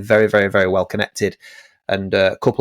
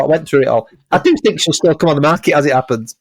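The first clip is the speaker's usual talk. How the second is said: ordinary delivery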